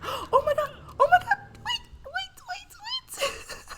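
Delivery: very high, loud shocked cries, half laughing; gasping oh my God, crying out and laughing; shocked squeal. Real, not acted. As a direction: shriek in real disbelief as the fight breaks out. Reacts to a fight in the game.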